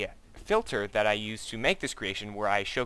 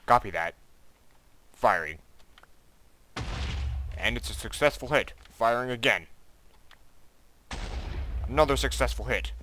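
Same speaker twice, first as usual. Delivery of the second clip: bad acting. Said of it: "excuse my terrible acting".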